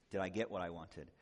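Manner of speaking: ordinary delivery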